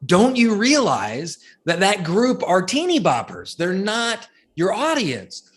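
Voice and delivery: high-pitched, emphatic disbelief, acted out; incredulous reaction. Group turning on the medical supplies seller, incredulous. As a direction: voice a whole room's exasperated "are you serious". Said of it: "looked at him with this face like".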